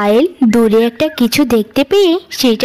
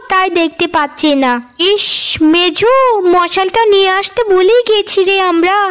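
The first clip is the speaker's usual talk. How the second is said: put-on a lightly higher, sugary older-sister voice; scheming elder sister; 8 semitones higher than usual. Elder sister coaxing Payel out at night, lightly higher and sugary. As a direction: sweet-talk the little sister into a trap.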